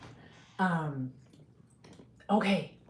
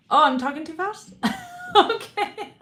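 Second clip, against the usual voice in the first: laughing; 12 semitones higher, 6 dB louder than usual. Real, not acted. Reacting to chat feedback.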